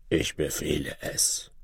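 low, soft disguised voice; weak voice. A narrator's reading. Disguised king commanding the sorceress, low weak voice. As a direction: give the order feebly, low and soft. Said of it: "Mit schwacher Stimme".

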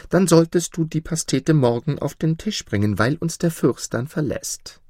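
high-pitched, lightly amused duke. A narrator's reading. Duke orders the pie from his cook, amused. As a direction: give the order in a lifted, jovial voice. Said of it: "entgegnete der Herzog lachend".

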